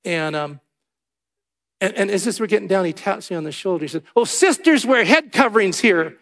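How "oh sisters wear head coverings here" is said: raised, louder voice imitating a correcting usher; mock officious announcement. Usher tapping a visitor on the shoulder, mock loud correction. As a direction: announce the rule over everyone, acted out.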